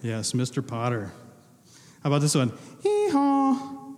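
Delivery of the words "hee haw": mock donkey bray. Quiz quote from the movie.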